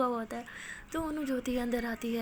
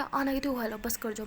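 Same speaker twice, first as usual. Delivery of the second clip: ordinary delivery, nothing marked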